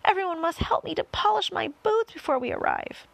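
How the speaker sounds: high, loud character voice; fussy centipede. A narrator's reading. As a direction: fret suddenly over a forgotten chore. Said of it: "the centipede said".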